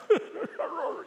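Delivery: sudden high-pitched laugh; rueful high laugh. Real, not acted. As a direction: burst out laughing at yourself. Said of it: "I wish I could cry like Rodney".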